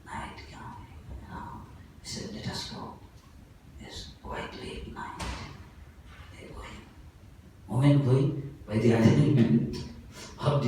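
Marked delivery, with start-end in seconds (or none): 0.1-6.8 whispered storytelling, barely voiced; hushed whisper. A narrator's reading. Storyteller whispers as the dacoits sneak in at night. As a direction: hush as dacoits creep up at night.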